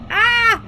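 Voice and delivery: loud, high "ah" scream; sudden full-voiced mock scream; short acted scream. Player imitating Mark's screams behind the door, loud high scream.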